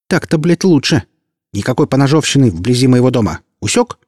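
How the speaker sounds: high, loud furious telling-off; girl yelling at her servants; angry scolding. A narrator's reading. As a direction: scold them into line. Olya scolds the warriors.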